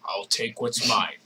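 lightly voiced low villain; a hint of a sinister stranger; masked villain voice. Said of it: "it's like the masked voice".

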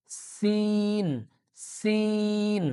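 সিন very slow with a sharp front s; sin from the front. Shown as a demonstration. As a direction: hold the s of সিন at the teeth. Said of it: "বাহির থেকে উচ্চারণ হবে".

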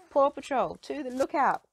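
high, loud rallying call. A narrator's reading. Ryder calling the pups to the lookout, high and loud. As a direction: summon the team loudly. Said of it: "calls Ryder".

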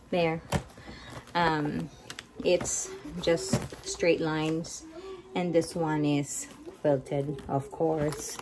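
muffled behind a mask; talking through a face mask. Real, not acted. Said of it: "Excuse the mask for Pia".

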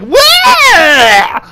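sudden high shout; mock scare yell. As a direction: jump out and yell it back. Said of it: "just be like".

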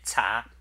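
"cha" repeated clearly with a rising tone; Chinese second tone. Shown as a demonstration. Streamer repeating the Chinese word for viewers, second-tone "cha". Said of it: "let me say it again".

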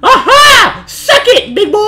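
high, loud victory shout; triumphant gloating. Real, not acted. Player gloating over escaping the chaser, triumphant shout. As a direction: crow in triumph at the beaten enemy. Gloats after beating the game's threat.

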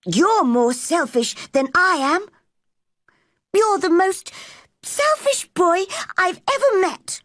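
put-on high-pitched quarrelling girl; angry retort. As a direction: throw the accusation back, pitch climbing. Mary hurls accusation back.

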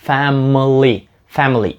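"family" as "fam-ly", middle vowel gone; two-syllable "family", stress first; correct FAMILY. Shown as a demonstration. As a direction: merge m and l in "family". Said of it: "І це правильно".

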